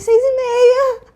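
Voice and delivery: high, loud retold blurt; acted eager reply. Woman telling waking partner the time, acted eager reply.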